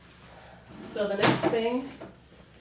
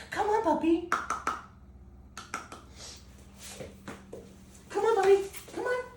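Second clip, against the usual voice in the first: much higher sing-song coaxing to a puppy; high puppy talk; 12 semitones higher than usual. Real, not acted. Trainer coaxing a tired puppy on the wobble board, high sing-song puppy talk.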